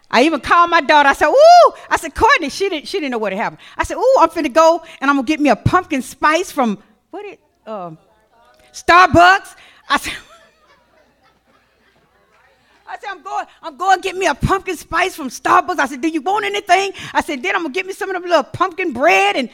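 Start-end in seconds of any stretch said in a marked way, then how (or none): 1.3-2.5 high, loud delighted squeal; excited squeal. Retold or imitated. Mother calling her daughter in excitement, high and loud. 4.0-19.4 raised, bright excited chatter; giddy excitement. Retold or imitated. Mother telling her daughter about Starbucks, excited.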